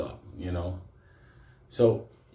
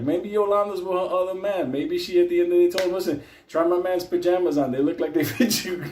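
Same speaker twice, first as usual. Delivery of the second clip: higher, mocking taunt; teasing mock gossip; 7 semitones higher, 3 dB louder than usual. Teasing the inmate about Yolanda's other man, higher. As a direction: rib him with glee. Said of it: "I was like".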